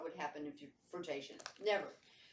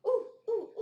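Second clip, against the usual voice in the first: high squealing oohs; mock thrilled; 7 dB louder than usual. Playing the thrilled hotel guest, high squealing oohs. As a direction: squeal with delight at the new toy.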